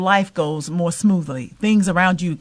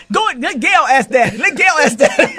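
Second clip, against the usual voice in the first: high, louder mock plea through laughter; laughing imitation of siblings; 8 semitones higher, 7 dB louder than usual. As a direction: imitate the sisters pushing Gail forward, laughing. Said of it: "they would always say".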